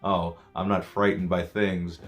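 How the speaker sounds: slow, low scoffing brag; mock dismissive. Mocking a fearless skeptic, slow and low. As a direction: mimic a smug skeptic, drawn out. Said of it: "one of those people that are like".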